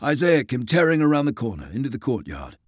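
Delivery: plain reading voice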